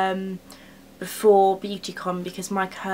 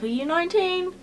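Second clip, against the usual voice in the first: mock incredulous question; 10 semitones higher than usual. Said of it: "They won't be like".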